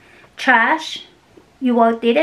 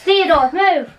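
raised call to get him moving; firm call, pitched higher; 6 semitones higher than usual. Real, not acted. To Theodore, blocking the way: raised higher call. Calling the dog out of the way.

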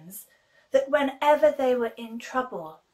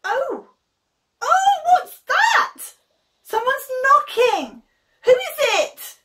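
much higher, loud startled cries; startled Rhoda; 10 dB louder than usual. Acted. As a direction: jump out of your skin at the knock.

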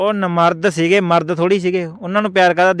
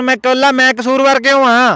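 higher and louder, a hammed-up defensive protest; indignant outburst; 5 semitones higher, 3 dB louder than usual. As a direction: protest loudly that he alone isn't to blame. Baba defends himself, raising voice. (ਉੱਚੀ ਆਵਾਜ਼ ਵਿੱਚ ਨਾਰਾਜ਼ ਬਹਿਸ)

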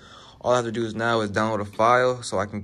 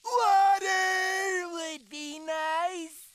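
high, slow, drawn-out cartoon plea; SpongeBob voice; much slower than usual. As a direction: croak then wail for water like SpongeBob. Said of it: "acting like SpongeBob when he wouldn't just say anything".

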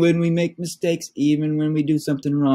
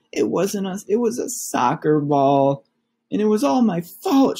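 urgent confession; 7 semitones higher than usual. Acted. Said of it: "shouted Brother".

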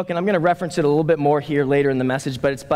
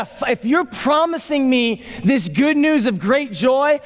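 acted urgency, pitched up, dragging out the last words; urgent eagerness; 8 semitones higher than usual. Shepherds promised joy, rushing to find it, urgent. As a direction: play someone determined to chase a promise. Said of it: "like, an urgency to them".

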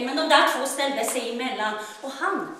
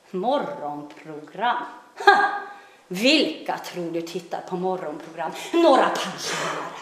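contemptuous dismissal, a bit lower; scornful mother; 7 semitones lower than usual. Acted. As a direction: scoff at the idea.

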